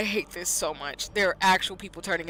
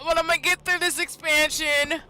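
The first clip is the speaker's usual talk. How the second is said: whiny resolve; 8 semitones higher than usual. Real, not acted. Player vowing to finish despite the horror, high whiny resolve. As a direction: whine it high while pushing on.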